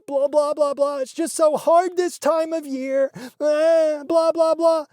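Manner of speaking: high, whiny mock of her mother; hammed-up fake-tearful mom; mocking whine. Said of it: "I am mocking her".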